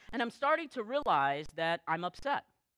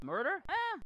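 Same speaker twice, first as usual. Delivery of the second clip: dismissive shrug; 9 semitones higher than usual. Pretend. Dismissing murder as irrelevant.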